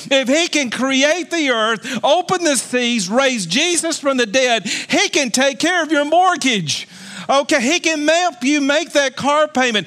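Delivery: full-force pulpit delivery; rousing sermon voice; fiery preaching. Acted. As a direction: preach it out, ringing to the whole congregation.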